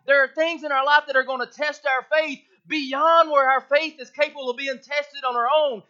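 loud, rising preacher's exhortation; a preacher pleading with his church about life's burdens; full-force preaching. Acted. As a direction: drive the message home to the congregation.

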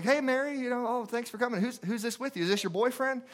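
high-pitched, friendly imitation of a sick friend; smiling greeting. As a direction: greet a visiting friend with a smile, voice lifted high. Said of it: "and Sue smiles".

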